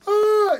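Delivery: short high hammed-up shriek of shock; mock scream. Wife finds her husband's dead body, mock horror scream. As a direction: squeal it like a B-movie heroine, tongue in cheek.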